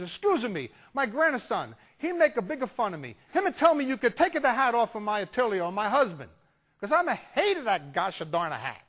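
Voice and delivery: complaining Italian grandma in broken English; Italian grandmother accent. Retold or imitated. Grandmother reports grandson to the photographer, indignant Italian accent. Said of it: "nice little old Italian lady".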